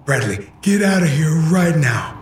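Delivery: father shouting, high and strained; angry shout. Acted. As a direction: yell it as a furious command. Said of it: "He was angry".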